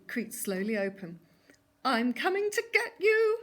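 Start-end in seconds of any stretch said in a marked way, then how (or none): 1.8-3.4 high, loud howl of attack. A narrator's reading. Wolf rushing at the opening door, loud high howl. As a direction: burst out howling as you pounce. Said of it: "howled Wilf".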